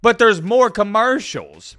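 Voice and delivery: slow outraged emphasis; loud indignant. Real, not acted. Ranting about commercials.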